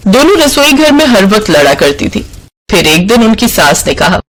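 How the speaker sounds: plain reading voice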